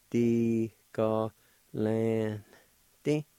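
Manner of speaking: "Di, ga, lan, di" broken into held syllables; syllable-by-syllable demo. Shown as a demonstration. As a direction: sound out "Di, ga, lan, di" piece by piece. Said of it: "like this".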